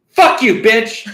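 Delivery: high, loud cursing burst; retold sudden outburst. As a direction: imitate mom suddenly lashing out. Mom's sudden outburst at nobody.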